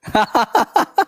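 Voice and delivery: a short real laugh; a quick chuckle at the class; amused laugh. Real, not acted. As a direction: laugh it off lightly. Laughing at students' replies.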